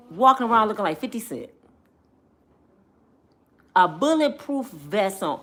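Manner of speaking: ordinary delivery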